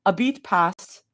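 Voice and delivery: ordinary delivery, nothing marked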